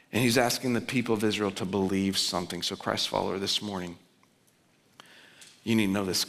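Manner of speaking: usual speaking voice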